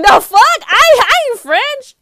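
indignant outburst. Acted. European wrongly greeted in French, loud indignant reply. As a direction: burst out offended and baffled. Acted offended reply to bonjour.

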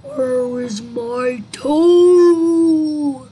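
very loud, slow, stretched groan; haunting creature groan, drawn right out; groaning ghostly voice. A narrator's reading. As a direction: hold the final word like a long wail. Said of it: "It groaned".

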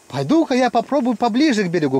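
a hint of a teasing imitation; imitating Kolya. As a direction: lightly mimic his boast. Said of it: "говорит".

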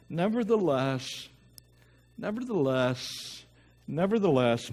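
"Nevertheless" repeated, each one pressed and slowing down; emphasized nevertheless. Shown as a demonstration. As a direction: lean hard on every "nevertheless". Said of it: "I'm emphasizing it".

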